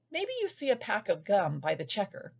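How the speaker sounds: ordinary delivery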